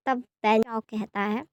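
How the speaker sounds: ordinary delivery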